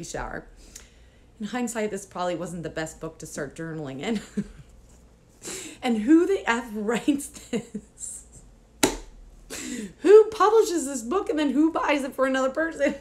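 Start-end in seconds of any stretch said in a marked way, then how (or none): 5.8-13.0 incredulous outrage. Real, not acted. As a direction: blast the book in joking disbelief. Mock outrage at gift book.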